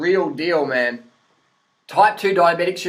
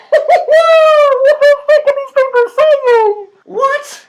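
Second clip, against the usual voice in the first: screeching high and loud, slow and stretched; outraged shriek; 11 dB louder, much slower than usual. Retold or imitated. Host shrieks at paleo gurus' claims, outraged shriek. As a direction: shriek it in total disbelief. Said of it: "And I'm like".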